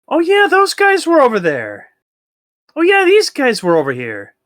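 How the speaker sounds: very high, loud silly voice; dopey creature voice. Pretend. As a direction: ham up the dim creature. Voicing dumb creatures higher.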